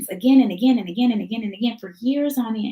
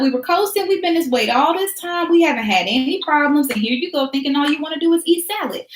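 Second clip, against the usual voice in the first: higher, alarmed voice of the body; panicking body; 3 semitones higher than usual. Pretend. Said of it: "Your body is like".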